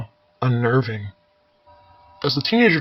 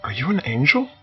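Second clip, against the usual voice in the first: blurting; 3 semitones higher than usual. Acted. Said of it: "blurting out a question".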